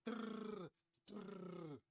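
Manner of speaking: a lightly voiced drrr of a gun; mock gunfire. Shepard shoots at the large man, mock gun rattle.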